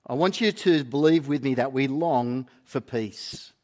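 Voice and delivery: normal talking voice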